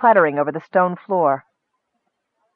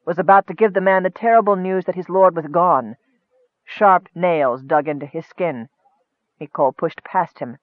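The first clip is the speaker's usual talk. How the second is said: usual speaking voice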